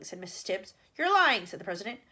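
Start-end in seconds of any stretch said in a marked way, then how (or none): 1.0-1.5 loud accusation; accusing. Acted. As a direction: fling the accusation at him. President accusing the premier.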